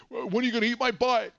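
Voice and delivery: high mock caller voice, pushed loud; imitating the prank caller. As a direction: deliver the prank line in the caller's put-on voice. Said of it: "He goes".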